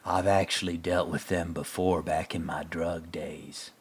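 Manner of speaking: hushed, secretive whisper. Retold or imitated. Rust recalling his drug days, whispered. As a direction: lean in and breathe it like a confession. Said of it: "Rust's like".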